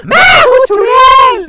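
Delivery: slow, loud, high witch shout. Acted. Mother playing a witch announces herself, slow, loud and high. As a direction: drag out the words like a witch. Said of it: "क्या acting करती हो mummy".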